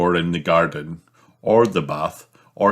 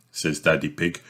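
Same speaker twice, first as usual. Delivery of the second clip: plain reading voice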